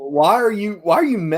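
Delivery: rising, disbelieving yell; loud teasing disbelief; incredulous shout. Real, not acted. Friends teasing over Monster House.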